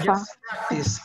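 ordinary talk, nothing marked

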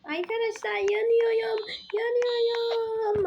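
high, strained yells of burning. Acted. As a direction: cry out like you are on fire. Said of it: "süper güçlüyüm mi oldu".

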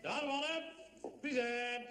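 ordinary talk, nothing marked

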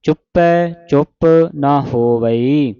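slow recitation, open ai in ਚੁੱਪੈ; double-lavan pronunciation. Shown as a demonstration. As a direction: stretch ਚੁੱਪੈ and ਹੋਵਈ, then rest. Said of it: "ਦਲਾਵਾਂ ਦੇ ਨਾਲ". (ਚੁੱਪੈ ਦਲਾਵਾਂ ਨਾਲ, ਹੌਲੀ ਪਾਠ)

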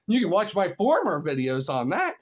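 normal talking voice

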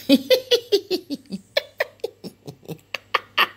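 put-on high, far-off girlish cackle; high mocking giggle. Reader giggling as the three mocking friends. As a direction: giggle like a cheeky schoolgirl. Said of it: "elles ont ri".

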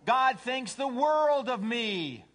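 high, loud, drawn-out boast; self-centered cat. Acted. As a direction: gloat slowly at full voice. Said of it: "A cat says".

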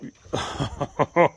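loud burst of laughter. Real, not acted. Owner watching a pet nearly catch it, loud laughing.

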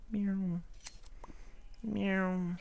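high mock cat meow; playful cat meow. Meowing at the cat.

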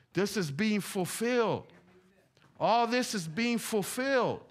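slow, high-pitched preaching, building; emphatic proclamation. Real, not acted. Preacher proclaims prophecy fulfilled.

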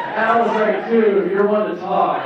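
laughing. Real, not acted. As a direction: laugh while saying it. Laughing retort.

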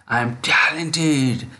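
drawn-out, deliberate affirmations for repeating; slow affirmation drill; slow model affirmations. Shown as a demonstration. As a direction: stretch each key word for students to copy. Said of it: "This way".

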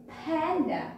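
Panda with a strong puff of air on the p; stressed p sound. Shown as a demonstration. Teacher asking children if they hear p, stressed p sound. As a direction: model "Panda" so the opening p stands out. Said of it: "do you hear this p sound?".

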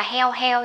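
HEO, ending dropped entirely; mispronounced health. Shown as a demonstration. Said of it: "Mình không đọc là heo heo". (đọc sai health thành "heo", mất âm cuối)

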